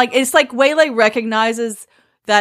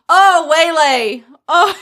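fond laugh; 6 semitones higher, 4 dB louder, much slower than usual. Real, not acted. Moved by the scene.

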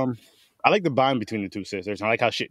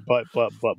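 ordinary delivery

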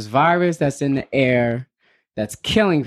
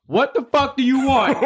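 mock fed-up dad; 12 semitones higher than usual. Dad losing patience, hammed up.

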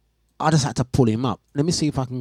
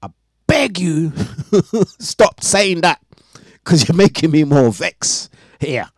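slow, louder pleading with a laugh; exasperated pleading; 4 semitones higher, 5 dB louder than usual. Retold or imitated. Retelling the chat with the agent, slow exasperated begging. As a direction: beg him to stop, fed up. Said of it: "I was like".

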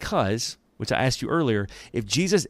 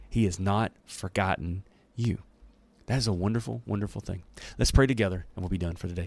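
normal talking voice

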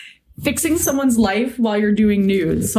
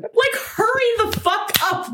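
higher pitch, words pushed out through real laughter; laughing hard; 10 semitones higher than usual. Real, not acted. Cohost repeating her impatient text while laughing hard. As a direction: crack up mid-line. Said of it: "I'm laughing so hard".

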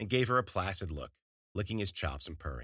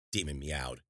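ordinary delivery, nothing marked

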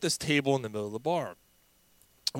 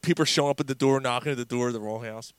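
usual speaking voice

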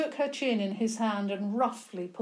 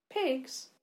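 startled; 6 semitones higher than usual. A narrator's reading. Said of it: "Clara managed to say".

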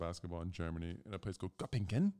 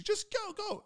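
very high, loud strained plea; desperate teammate; 4 dB louder, slower than usual. Retold or imitated. Teammate begging friends to leave, a very high strained plea. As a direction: squeak it out in desperation. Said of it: "He's just waving".

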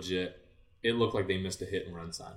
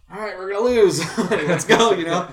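much higher, loud, laughing shout; mock-doomed rally cry; 12 semitones higher, 15 dB louder than usual. Team facing the top-ranked rivals, laughing loud rally cry. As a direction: yell it half joking, bracing for defeat.

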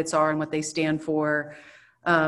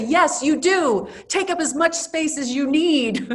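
heartfelt cheering-on; 8 semitones higher, 4 dB louder than usual. Retold or imitated. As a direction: cheer her on emphatically, pitched well up. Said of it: "go and hug her and say".

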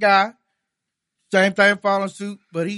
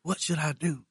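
whispered in church; 3 semitones lower, 7 dB quieter than usual. Retold or imitated. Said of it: "he said".

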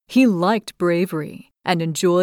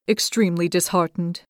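usual speaking voice

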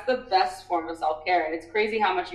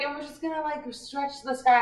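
serene instructor; 5 semitones higher than usual. Retold or imitated. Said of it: "And she's like".

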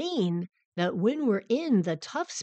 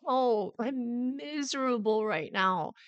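drawn-out, higher-pitched miserable moan; miserable, near crying; 5 semitones higher, slower than usual. Acted. Voicing her own exhausted body wanting to cry, miserable moan. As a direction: imitate a body moaning in misery, very slowly. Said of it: "my body wanted to cry".

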